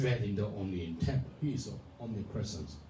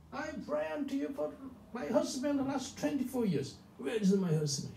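higher, pitched-up voice of an angry woman crying out; angry complaint to God; 12 semitones higher than usual. Retold or imitated. Praying woman scolding God for no husband, angry and pitched high. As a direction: accuse God of breaking his promise, much higher. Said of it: "She was so angry".

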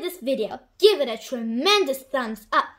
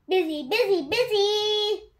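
bustling repetition; 7 semitones higher than usual. A narrator's reading. Narrator evokes a bustling bazaar.

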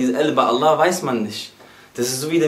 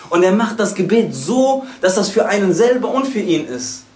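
fervent preaching; 5 semitones higher than usual. Acted. Preacher to congregation on mercy, preaching with fervor. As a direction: preach it up, pressing each question.

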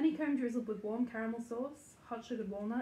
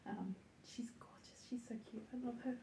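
softer whispered fondness; 2 semitones higher, 9 dB quieter than usual. Real, not acted. Owner whispers fondly about the puppy. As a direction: whisper it so the pup stays calm.

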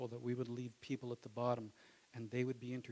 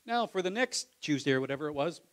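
imitating the pastor; 3 semitones higher, 8 dB louder, faster than usual. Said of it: "and the pastor says".

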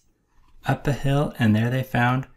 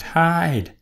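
higher, urgent call; quacked warning; 7 semitones higher than usual. A narrator's reading. Duck telling everyone to hide, urgent quack. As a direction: blurt the warning. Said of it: "Duck quacked".

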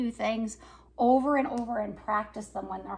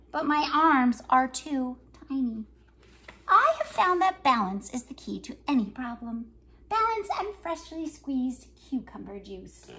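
lively picture-book reading with voices for each character; reading to children; 5 dB louder than usual. Acted. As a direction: read it like story time for little kids. Reads picture book aloud.

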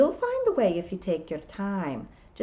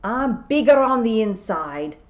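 much louder, higher comeback; indignant retort; 5 semitones higher, 10 dB louder than usual. A narrator's reading. Tiffany answering being called small, indignant. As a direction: retort loudly, stung.

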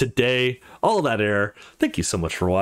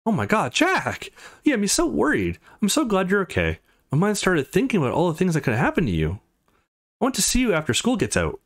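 lightly voiced girl, higher and relieved; worried girlfriend texting; 7 semitones higher than usual. Girlfriend texting Jack after he went missing, relieved higher voice. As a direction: read her texts as a worried, relieved girl. Texts read in a character voice.

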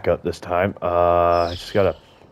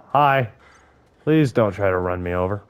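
ordinary talk, nothing marked

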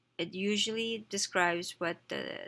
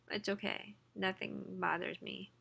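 usual speaking voice

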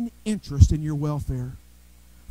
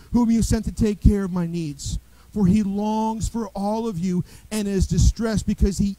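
louder, measured Bible reading; scripture reading; 3 semitones higher, 5 dB louder than usual. Real, not acted. Preacher reading Philippians aloud to the congregation, measured and firm. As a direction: read the passage with steady weight.

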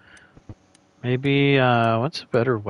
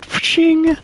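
high loud imitated headshot ching; 5 dB louder than usual. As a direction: ring out the headshot sound. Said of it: "I love that sound".

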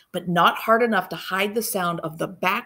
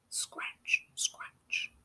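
breathy scratch sound effect; whispered scratching; 14 dB quieter than usual. Acted. Kids hiding, the thing scratching at the door, whispered.

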